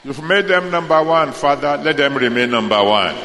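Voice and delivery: fervent, rising intercession; prayer. Acted. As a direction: plead boldly over the congregation. Prayer for firstborn family.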